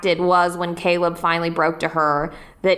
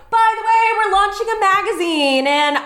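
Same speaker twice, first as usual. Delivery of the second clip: grand announcement; 7 semitones higher than usual. Retold or imitated. Julie's grand balcony announcement.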